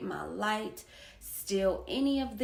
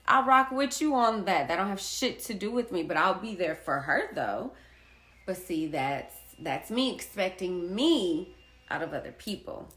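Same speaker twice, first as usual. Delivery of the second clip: ordinary delivery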